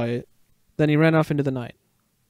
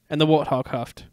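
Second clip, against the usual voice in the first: usual speaking voice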